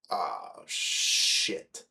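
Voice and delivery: low, drawn-out hushed curse; retold dawning dread. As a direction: let it sink out under your breath. Retold sinking realization of charge.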